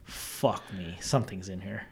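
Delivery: low, very soft undertone; quiet re-enacted dread; hushed unease. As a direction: say it to yourself, barely aloud. Retelling sensing a presence.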